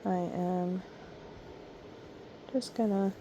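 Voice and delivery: ordinary delivery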